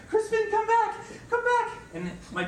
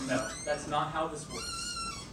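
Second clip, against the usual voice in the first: patient scolding; slower than usual. Acted. Scolding the dog slowly.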